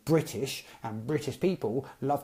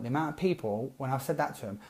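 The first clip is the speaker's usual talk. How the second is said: ordinary delivery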